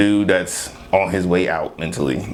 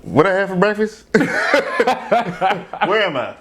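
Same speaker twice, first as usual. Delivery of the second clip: higher, laughing mock confusion; Biden impersonation; 9 semitones higher, much slower than usual. Co-host mocking a forgetful politician, laughing impression. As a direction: play a lost politician through laughter. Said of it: "doing the Biden impersonation".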